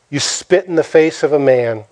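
normal talking voice

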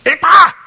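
high loud exasperated ah; frustrated cry. Retold or imitated. Preacher acting Job's frustration with a loud high ah. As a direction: let out a burst of frustration.

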